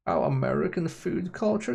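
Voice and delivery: ordinary delivery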